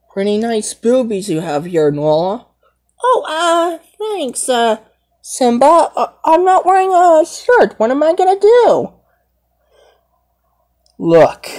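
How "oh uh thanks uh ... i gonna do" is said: high, flustered, speeding up; embarrassed girl lion. Pretend. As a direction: panic shyly as Nala. Nala embarrassed, no shirt.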